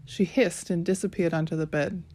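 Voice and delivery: usual speaking voice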